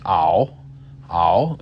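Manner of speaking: "ow" rounded and open; "ow" with the mouth rounded wide. Shown as a demonstration. Teacher demonstrating Mandarin "ow" to learners. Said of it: "It's like an open ow sound".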